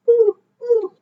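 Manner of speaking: high owl hooting; playful high-pitched owl call. Pretend. Imitates an owl's hoot.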